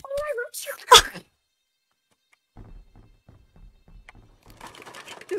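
high loud slow cackling cry; monster's shrill victory cry; triumphant shriek. Pretend. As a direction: gloat over the kill. Monster rips off arm.

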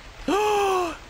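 sharp high gasp of shock. Real, not acted. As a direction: gasp in fright. Finds dead body.

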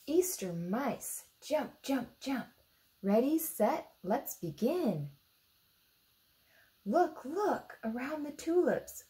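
lively, sing-song picture-book reading; reading to children. A narrator's reading. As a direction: read it bright and bouncy for little ones.

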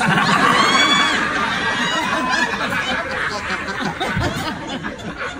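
high shrieking laugh; real high-pitched cackle. Real, not acted. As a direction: howl with laughter. Laughs at aunt's text.

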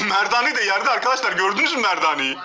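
loud, appalled yelling; shocked shouting. Real, not acted. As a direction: yell out in disgust at the footage. Shocked reaction to the dirty kitchen.

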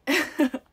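high, loud little burst of laughter; short laugh. Real, not acted. Streamer holding freshly baked bread, short embarrassed laugh.

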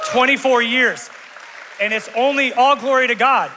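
loud, high, ringing praise; joyful shout. Real, not acted. Pastor celebrating twenty-four years of marriage, shouting joyfully. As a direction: proclaim it out over the whole room.

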